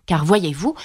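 ordinary delivery

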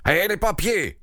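high, agitated demand; agitated growl. A narrator's reading. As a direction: demand it urgently, pitch jumping up. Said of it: "gronda le roi".

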